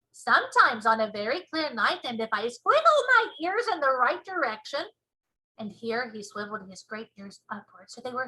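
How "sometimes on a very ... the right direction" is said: BFG giant voice. Acted. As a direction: show off the ears proudly.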